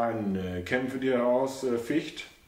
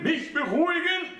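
much higher, outraged echo; high old mother's voice, scornful question; indignant old mother. A narrator's reading. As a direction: repeat it in disbelief.

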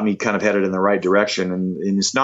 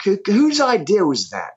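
much higher, different-timbred star voice; 12 semitones higher than usual. Retold or imitated. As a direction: put on the rock legend's lilting voice. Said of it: "he's like".

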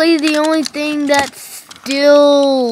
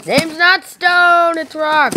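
high, slow brute correction; indignant caveman correction; brute correcting; 6 semitones higher, slower than usual. Acted. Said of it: "where did stone go".

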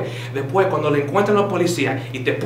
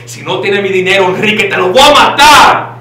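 furious shout, higher and much louder; mock threat; 5 semitones higher, 11 dB louder than usual. Fake teacher yelling a threat instead of hello. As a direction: scream the threat at Enrique. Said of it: "The proper way to say it in Spanish is".

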